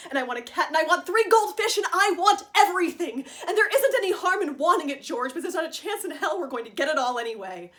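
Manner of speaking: impassioned demand. Acted. Wife listing to George everything she wants, building impassioned demand.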